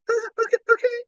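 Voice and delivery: high, breathless relieved babble. Retold or imitated. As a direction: gush with relief at not being fired. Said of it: "And I'm like".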